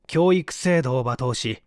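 usual speaking voice

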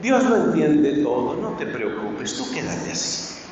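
slow, hushed, lulling mockery of easy comfort; mock soothing. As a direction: cradle them in false comfort, slowly. Mocking a lax, comforting preacher. (consuelo burlón, lento y susurrado)

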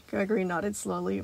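plain reading voice

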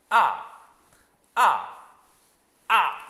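"아" starting high, plunging sharply, loud; fourth tone. Shown as a demonstration. Teacher modelling the fourth tone, loud sharp drop. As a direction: fire "아" straight down from the top. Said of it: "내리 꽂아야 돼요".

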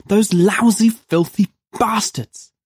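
high-pitched indignant cursing; incensed. A narrator's reading. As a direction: curse them bitterly, drawing out the last words.

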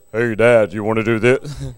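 low deep voice with a laugh; deep man voice, laughing; deep teenage voice. Retold or imitated. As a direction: drop low like a grown son. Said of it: "he's like".

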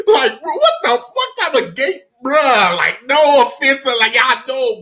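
high, loud incredulous outburst; amused disbelief. Real, not acted. Storyteller appalled at own search, amused disbelief. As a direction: protest in squeaky disbelief.

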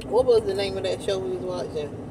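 talking around a spoon; eating ice cream while talking; spoon at lips. Real, not acted. Seen 1: Spoon with ice cream rests at lip corner while she talks.

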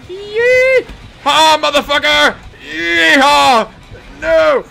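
high, very loud whoops and shouts; full-voice high hollering; wild yelling. Real, not acted. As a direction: yell it wildly, mid-chase. Whooping and yelling in game chase.

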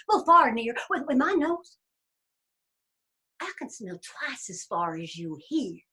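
loud, blustering bear voice; boastful bear. Acted. Bear challenging the rabbit to smell, boastful. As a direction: brag grandly as the bear.

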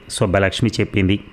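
plain reading voice

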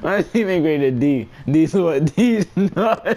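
high-pitched, cracking up on the punchline; laughing hard. Real, not acted. Streamer retelling a chat prank to viewers, laughing hard. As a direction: joke that collapses into helpless laughter. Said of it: "I'm laughing so hard".

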